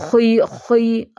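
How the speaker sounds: खुई said slowly, only lightly heavy; lighter heavy letter. Shown as a demonstration. Teacher showing students lighter heavy letters, slow careful demo. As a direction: draw out खुई without extra fullness. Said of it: "इनको ज़्यादा extra मोटा नहीं".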